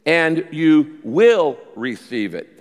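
very slow, "will" drawn out and punched; deliberate reading that singles out "will"; stress on will. Shown as a demonstration. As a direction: lean hard on "will". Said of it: "Notice the change in tense here".

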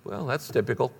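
jaded believer taking prayer for granted; bored shrug. Retold or imitated. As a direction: shrug it off as nothing special. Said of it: "you go".

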